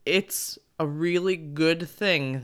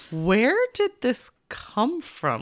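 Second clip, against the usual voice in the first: high, puzzled questioning, a bit louder; baffled parent; 9 semitones higher, 3 dB louder than usual. Retold or imitated. Mom reacting to daughter's random accents, high and baffled. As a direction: ask it like you can't believe your ears. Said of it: "and I'm like".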